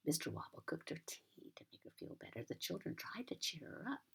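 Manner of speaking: usual speaking voice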